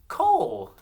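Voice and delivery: Cole very high and loud; high, loud Cole, falling at the end; name called out. Shown as a demonstration. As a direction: call out Cole for the class to echo. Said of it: "say this name with me".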